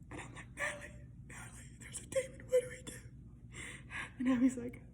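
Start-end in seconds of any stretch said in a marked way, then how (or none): 0.6-3.0 much higher, fast, whimpering whisper; retold panicked whisper. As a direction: whisper in terror, near tears.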